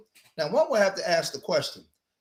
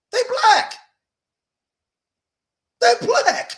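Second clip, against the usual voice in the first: louder and higher, fired up; heated outburst; 7 semitones higher, 5 dB louder than usual. Real, not acted. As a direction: hammer the point home with rising heat. Heated rebuttal about Cuban history.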